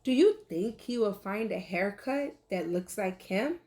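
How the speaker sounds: ordinary delivery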